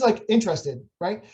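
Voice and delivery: usual speaking voice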